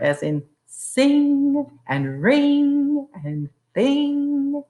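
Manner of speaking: sing, ring, thing stretched slowly, ng held long; slow, rising; drawn-out ng words. Shown as a demonstration. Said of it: "often there's an I there".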